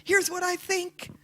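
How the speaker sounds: an inner voice thrilled to be asked at last; excited inner voice. Acted. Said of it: "wise voice is so excited".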